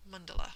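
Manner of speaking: mandala stressed on the first syllable, schwas after. Shown as a demonstration. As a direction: hit the start of mandala and reduce the other A's. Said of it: "the accent is actually on the first syllable".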